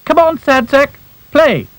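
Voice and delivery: high cheering coax; coaxing squeaky voice. Acted. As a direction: urge him on brightly. Chorus coaxing Sad Sack.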